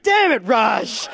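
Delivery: slow, half-shouted through a laugh; cracking up while cursing a friend; laughing exasperated. Retold or imitated. Teller to Raj, laughing exasperated shout.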